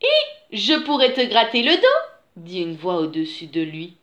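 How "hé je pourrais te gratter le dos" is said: raised, louder storybook character voice; lightly voiced she-bear. A narrator's reading. A voice in the branches offers to scratch his back, lightly voiced she-bear. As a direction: call out an offer from above.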